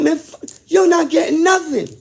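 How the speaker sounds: high, loud yell of defiance at the guards; angry shout. Retold or imitated. Cuffed prisoner refusing the guards, yelling defiantly. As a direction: shout it back, flat refusal. Defying guards from his cell.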